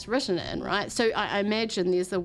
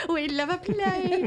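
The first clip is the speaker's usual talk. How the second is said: slow, higher, laughing sing-song; 10 semitones higher than usual. Acted. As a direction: stretch it out while laughing. Host repeats catchphrase, hammier.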